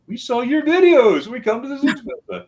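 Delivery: excited tourists. Retold or imitated. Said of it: "and they said".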